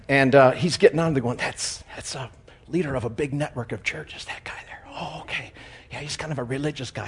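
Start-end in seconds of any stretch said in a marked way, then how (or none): 1.4-7.1 hushed, confidential whispered gossip; mock whisper. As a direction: whisper it like staff pointing out a passenger. Attendants confide about passenger, acted aside.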